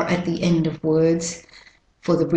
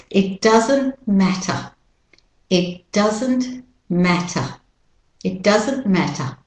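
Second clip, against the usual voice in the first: British Australian, "matter" ending in schwa; British Australian pronunciation. Shown as a demonstration. Teacher models the phrase for learners, British Australian accent. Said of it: "Australian, British pronunciation".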